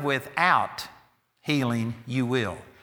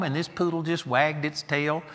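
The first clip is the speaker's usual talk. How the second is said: ordinary delivery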